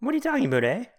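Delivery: a light mock Canadian lilt on eh; a playful Canadian eh; Canadian accent. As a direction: tease it in a Canadian voice.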